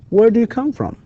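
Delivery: starts high, drops on "from"; high teacher's drill question coming down at "from"; falling intonation. Shown as a demonstration. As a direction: model an information question, pitch falling to "from". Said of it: "and falling intonation here".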